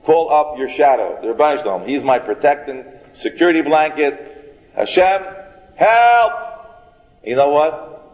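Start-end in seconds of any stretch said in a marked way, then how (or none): 4.8-6.3 high, loud cry for help; screamed plea. Shown as a demonstration. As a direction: scream out to heaven for rescue. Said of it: "You don't have to scream so loud".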